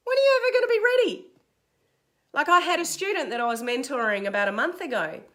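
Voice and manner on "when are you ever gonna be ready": high, fast, louder burst; exasperated challenge. Acted. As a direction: fire back at the excuse-maker, incredulous. Exasperated retort to the excuses.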